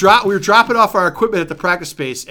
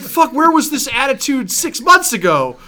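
high, loud exasperated outburst; 9 semitones higher, 3 dB louder than usual. Retold or imitated. Singer exasperated at a bandmate's late enthusiasm. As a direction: throw up your hands at him. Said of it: "I was just like".

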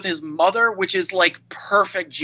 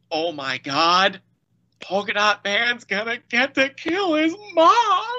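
half-laughing crying quote, much higher; tearful retelling; 5 semitones higher than usual. Retold or imitated. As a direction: voice rising high into a choked sob. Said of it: "I just start to weep".

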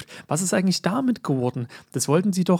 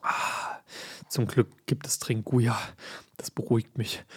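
soft sigh after a sip; while sipping a drink; 4 semitones lower, 8 dB quieter than usual. Real, not acted. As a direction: talk between sips of a drink. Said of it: "ich muss noch mal kurz einen Schluck nehmen".

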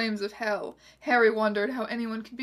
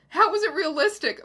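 higher-pitched baffled question; uninformed inquiry; 7 semitones higher, 4 dB louder than usual. A narrator's reading. Voldemort questioning the Reverend, baffled inquiry. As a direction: ask in exasperated bafflement. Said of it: "Voldemort inquired uninformedly".

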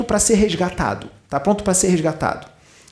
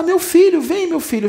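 mock crying mother; 11 semitones higher, 4 dB louder than usual. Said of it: "já vai chorando".

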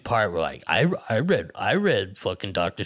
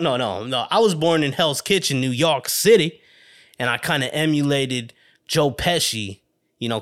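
usual speaking voice

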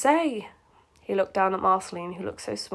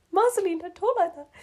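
much higher, melodramatic plea; hammed-up pleading; 9 semitones higher than usual. Marshall pleads with Marceline, in mock melodrama. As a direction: plead like a soap hero, half laughing.

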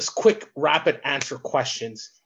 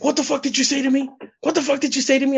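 higher, fast, heated what the fuck; retold angry officer; 9 semitones higher, faster than usual. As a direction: imitate the border officer flipping out. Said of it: "he fucking flipped out on me".

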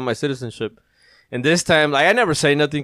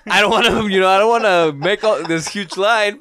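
mock flustered lady; 7 semitones higher, 5 dB louder than usual. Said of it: "her mentality was like".

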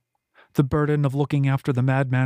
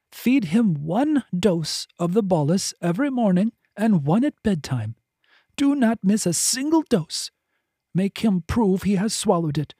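a higher, urgent voice pressing each instruction; insistent healer; 5 semitones higher than usual. Acted. Finta handing over the drugs, higher and insistent. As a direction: drive the orders home, no room for refusal. Finta gives strict dosing orders.

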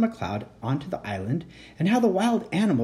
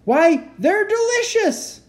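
much higher, louder, slow delighted shout; delighted yell; 7 dB louder, slower than usual. Acted. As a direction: jump up yelling with joy.

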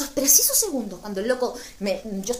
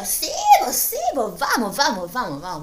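eager yes; 5 dB louder than usual. Retold or imitated. Agreeing loudly to the party trip to Valpo. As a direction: jump at the invite, all fired up.

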